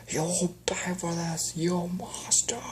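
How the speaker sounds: slow high seething threat; vengeful threat. Acted. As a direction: spit the threat slowly. Threat to villain.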